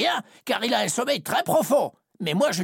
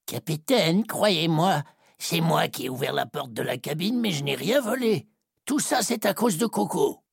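pleading sailor; 11 semitones higher than usual. A narrator's reading. Roger on his knees begging the captain, mild plea. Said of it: "il supplie".

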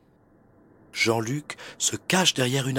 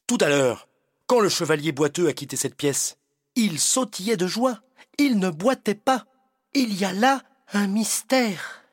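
eager exclaim; 7 semitones higher than usual. Acted. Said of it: "il s'écrie".

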